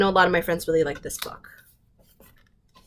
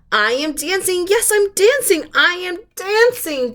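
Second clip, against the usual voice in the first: joyful cry; 10 semitones higher than usual. A narrator's reading. Gerald realizing he can dance, joyful higher cry. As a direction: burst out with delight. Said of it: "Gerald cried".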